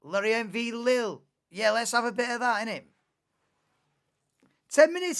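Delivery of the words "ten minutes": loud, high mocking "Ten minutes"; mock loud quote. Streamer mocking the race official's promise, loud high quote. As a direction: throw the official's words back, mocking and loud. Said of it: "he said".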